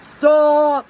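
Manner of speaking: loud, firm teacher command; teacher stop voice. Retold or imitated. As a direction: stop a kid in the hallway. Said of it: "my whole teacher, "Stop" voice".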